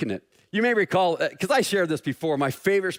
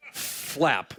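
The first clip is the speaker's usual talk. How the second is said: ordinary talk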